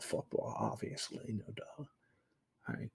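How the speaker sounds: breathy under-the-breath whisper, no voice; whispered aside. Real, not acted. Streamer whispering a scornful aside to himself. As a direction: mutter it to yourself in a whisper.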